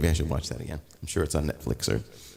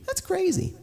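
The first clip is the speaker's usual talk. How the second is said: much higher, cartoon-like squeak; squeaky high voice. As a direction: echo yourself in a tiny high voice. Repeats phrase in two voices.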